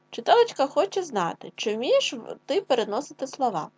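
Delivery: ordinary talk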